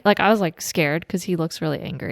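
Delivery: usual speaking voice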